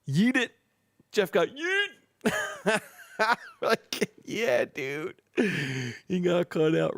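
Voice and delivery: drawn-out silly high voice; goofy high taunt. Real, not acted. Host slowly teases Jeff in a goofy high voice. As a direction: stretch it slowly like a playful taunt. Joking that Jeff got kicked.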